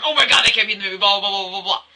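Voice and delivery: loud, excited fangirl gush; mock fangirl squeal; fangirl freakout. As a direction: gush like an obsessed fan. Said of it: "one of the evil fangirl moments going".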